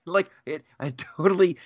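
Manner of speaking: ordinary talk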